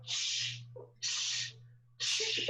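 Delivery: three short voiceless shushes; repeated shush. Shown as a demonstration. Laughter yoga leader demonstrating an exercise, shushing. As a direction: shush sharply, evenly spaced. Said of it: "and you go".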